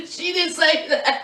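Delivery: talking through real laughter; laughing. Real, not acted. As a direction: admit it while cracking up. Laughing after her own invented quote.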